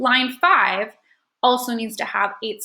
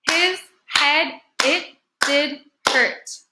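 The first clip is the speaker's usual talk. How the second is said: slow and higher, each word set apart; slow syllable-by-syllable read; clapped-out syllables; 4 semitones higher, much slower than usual. Shown as a demonstration. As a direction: count it out one beat at a time. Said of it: "clap and count the syllables".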